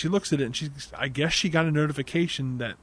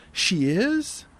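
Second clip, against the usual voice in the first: much higher, eager questions; startled surprise; 5 semitones higher, much slower than usual. Retold or imitated. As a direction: jump on the news. James startled by the news.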